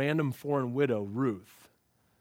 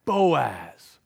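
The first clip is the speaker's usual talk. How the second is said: louder "Boaz." landing as a reveal; dramatic reveal; 2 semitones lower, 6 dB louder than usual. Shown as a demonstration. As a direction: drop "Boaz." like a big reveal. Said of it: "showing us and slowing us down".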